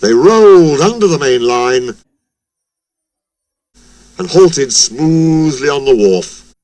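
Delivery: ordinary delivery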